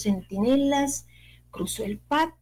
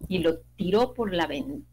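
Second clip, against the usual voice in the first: ordinary delivery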